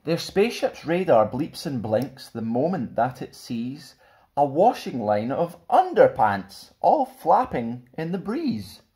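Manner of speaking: put-on lively, bouncy picture-book narration for kids; animated rhyming read-aloud; storybook reading. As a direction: read it like a fun bedtime story to children. Reads picture book to children.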